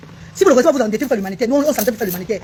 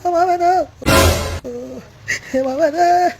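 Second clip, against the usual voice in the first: long drawn-out, higher wail; distressed wailing; 3 semitones higher, slower than usual. Real, not acted. Distraught woman calling on her mother, wailing. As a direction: cry out for mother in anguish.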